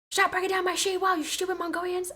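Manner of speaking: high-pitched cartoon impression; South Park character. Quoting a favorite South Park line, high cartoon impression. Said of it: "I love South Park".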